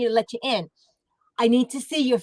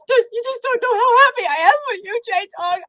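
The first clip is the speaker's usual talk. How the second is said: mock proud gush; 12 semitones higher, 4 dB louder than usual. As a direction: swoon over him like a proud mother, hamming it up. Mock gushing at J Dog.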